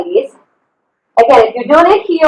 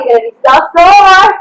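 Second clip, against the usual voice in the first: ordinary talk, nothing marked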